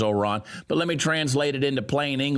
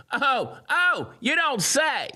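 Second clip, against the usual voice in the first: much higher, mock-surprised oh oh; sarcastic; 12 semitones higher, slower than usual. Real, not acted. Mocking the mayor's plea with high sarcasm. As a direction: drip sarcasm, pitch way up.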